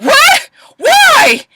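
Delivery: very high mock shriek; exaggerated high-pitched shock; shocked outcry. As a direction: squeal each question in acted disbelief. Acting out Annalise's shock at death.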